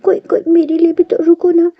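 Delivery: panicking to be left behind; scared. A narrator's reading. Stranded up a tree, calling for the others to wait, scared. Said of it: "वांग जी डरता है".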